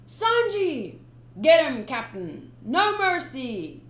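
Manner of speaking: loud cheering pirate; egging the captain on loudly; shouting pirate crew. Acted. Crewman cheering the captain on, loud.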